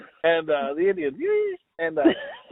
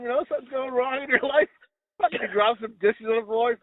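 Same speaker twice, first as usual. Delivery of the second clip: ordinary delivery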